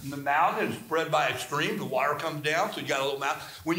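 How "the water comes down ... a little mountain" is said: ordinary talk, nothing marked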